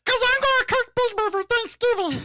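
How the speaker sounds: very high, squeaky puppet answer; reply in piercing puppet falsetto; Elmo. Acted.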